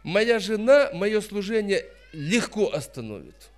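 usual speaking voice

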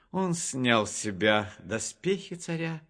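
plain reading voice

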